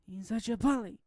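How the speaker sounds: high, loud mock sobbing wail; hammed-up tearful complaint. A narrator's reading. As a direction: cry it out in a high-pitched whine. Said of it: "I heard sobbing".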